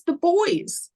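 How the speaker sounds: put-on high, simple child answer; little girl voice. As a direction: say it like a small girl. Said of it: "she goes".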